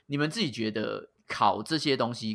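ordinary talk, nothing marked

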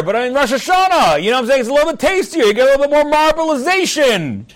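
hammed-up leader calling out to the feast; Nehemiah's proclamation. Nehemiah booms at the crowd to go feast, hammed-up proclamation. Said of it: "and he said to them".

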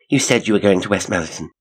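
quick words after a heavy breath; breathless explaining. A narrator's reading. Janet explains to the man she chased, fast and breathless. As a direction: explain in a hurry, catching your breath.

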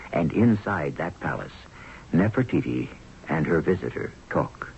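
ordinary talk

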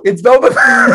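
high, loud thrilled recognition; excited gasp reaction. Retold or imitated. Fan thrilled to see him, high and loud. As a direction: gasp out the name in delight.

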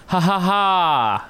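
slow deliberate ha ha ha; fake laugh. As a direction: do a flat forced laugh. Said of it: "His fake laugh is".

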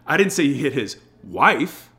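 WIFE held back a beat, then punched; stress on WIFE. Shown as a demonstration. Sales coach shows how emphasis changes meaning, stress on WIFE. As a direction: pause slightly, then land on WIFE. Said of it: "the emphasis that we put on the words".

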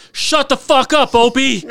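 loud, high, slow heckle; heckling yell. Retold or imitated. A firefighter heckling the director, loud drawn-out yell. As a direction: bellow it from the back of the crowd. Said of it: "someone in the crowd yells out".

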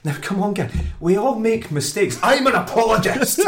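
high, impassioned plea to the fans; Sheridan impression. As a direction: preach it like a rally speaker. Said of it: "My favourite bit was".

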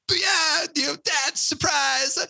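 put-on very high, squeaky child's voice springing a surprise on Dad; excited little kid. Five-year-old son surprises Navy captain dad coming home, squeaky high child voice. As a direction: play yourself at five, jumping out with glee.

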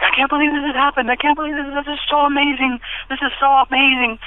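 high, fast and awed; amazed excitement. Retold or imitated. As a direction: gush with disbelief. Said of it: "I was off the charts".

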